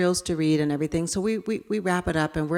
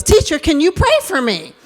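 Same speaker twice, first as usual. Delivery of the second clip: put-on higher, louder boy begging; pleading child; 9 semitones higher, 9 dB louder than usual. David asking his teacher to pray for him, pleading and louder. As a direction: call out earnestly like a small boy asking. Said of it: "And he goes".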